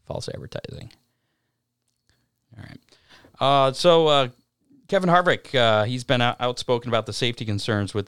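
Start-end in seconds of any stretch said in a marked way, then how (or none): 0.0-2.8 breathy whispered aside. Real, not acted. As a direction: whisper it under your breath. Muttered aside after failed call.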